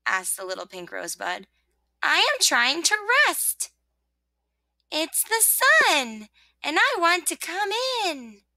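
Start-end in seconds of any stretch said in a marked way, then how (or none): 2.0-3.7 high, slow protest; complaining rosebud. Acted. Rosebud trying to rest, complaining. 4.9-8.4 high, singing-out sun greeting; cheerful little voice, drawn out slowly; soft, cheery voice. Acted. Sun asking rosebud to let it in, cheery. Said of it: "said a soft and cheery voice".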